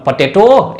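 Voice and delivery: potato rising onto a long "TO"; wrong last-syllable stress. Shown as a demonstration. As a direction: punch the final syllable of potato, "pota-TO". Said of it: "ऐसा भी नहीं है".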